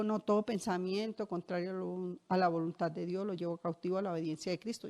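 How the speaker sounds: soft, fast murmured recital of a verse; quietly reciting her own prayer formula. Retold or imitated. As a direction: rattle it off under your breath. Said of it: "Entonces yo digo".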